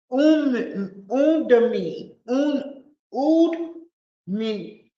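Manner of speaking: Udemy fumbled as "Oon-duh-mee", in halting attempts; unsure pronunciation. Real, not acted. Trying an unfamiliar site name on listeners, unsure pronunciation. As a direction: stumble over Udemy with an OO start. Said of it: "I don't know how to pronounce it".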